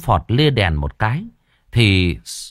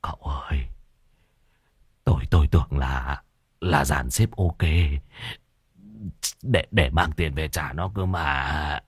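feeble whisper; 6 semitones lower, 6 dB quieter than usual. Acted. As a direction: barely breathe the words out in fright. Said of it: "Môn Phọt thều thào".

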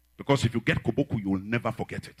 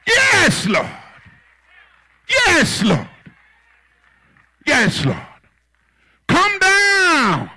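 high, drawn-out shouts that fall away; full-voiced, high holler; loud calling out; 14 dB louder, much slower than usual. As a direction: cry it out to the back of the church. Calling out to the Lord.

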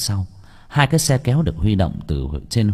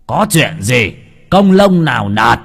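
loud, rising call to a man far off; shouting through cupped hands; 3 semitones higher, 7 dB louder, slower than usual. Acted. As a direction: cup your hands and bellow the question. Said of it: "chắp loa lên miệng hô".

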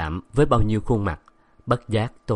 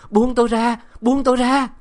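much higher, louder scream to be let go; terrified shout; 7 dB louder than usual. Acted. Girl screaming at the madwoman to release her. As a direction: scream it, desperate to break free. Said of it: "Tôi la lớn".

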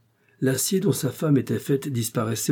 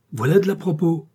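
higher and louder outcry; exclaiming; 7 semitones higher, 5 dB louder than usual. Acted. Husband exclaims at wife's invitation, loud. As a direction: cry it out in dismay. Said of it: "s'écria".

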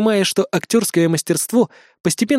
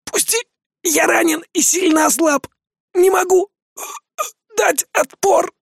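strained, high, groaning cry to be let go; groaning plea; 12 semitones higher than usual. Acted. Wounded Korean begs Mark to let go, groaning. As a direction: grunt it out through pain, pitched high. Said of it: "прокряхтел он".

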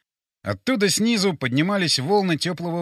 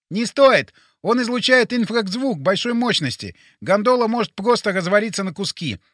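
high, insistent character voice; brief them urgently, pitched up; urgent Boris; 5 semitones higher, 4 dB louder than usual. Acted. Plays Boris.

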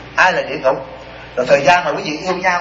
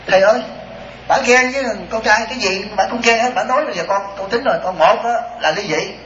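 higher-pitched mock complaint; complaining daughter-in-law; 6 semitones higher than usual. Daughter-in-law griping to her teacher about mother-in-law, mock exasperation. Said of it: "có con nhỏ kia nó nói".

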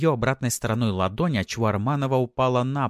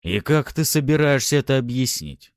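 loud, raised, accusing; angry growl; 6 semitones higher, 6 dB louder than usual. A narrator's reading. Husband confronting his wife, angry growl. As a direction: growl the question angrily. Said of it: "зло прорычал он".

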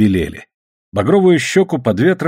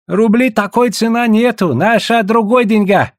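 protesting; 10 semitones higher than usual. A narrator's reading. Trader refusing to price in roubles, protesting. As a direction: object in a high, insistent voice.

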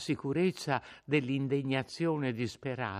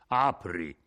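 stern order; 5 semitones lower, 4 dB louder than usual. A narrator's reading. Innominato orders at door.

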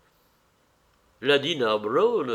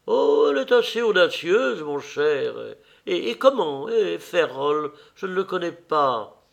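high-pitched society lady; teasing old lady, high voice; lively old hostess; 6 semitones higher, 4 dB louder than usual. Acted. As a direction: play the chattering hostess, pitched up.

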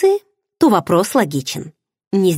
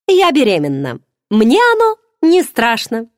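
confident; 5 semitones higher, 4 dB louder than usual. A narrator's reading. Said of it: "уверенно произнесла подруга".

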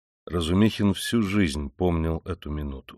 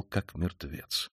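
ordinary delivery, nothing marked

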